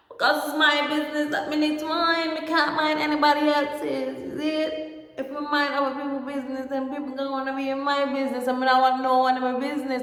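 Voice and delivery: put-on high, fast West Indian accent. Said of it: "it's the West Indian accent".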